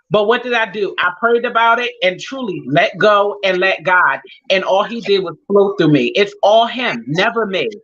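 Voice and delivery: ordinary talk, nothing marked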